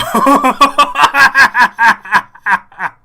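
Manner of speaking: very loud, high cackling laugh; helpless laughter. Real, not acted. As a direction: lose it completely laughing. Helpless laughing at an on-screen image.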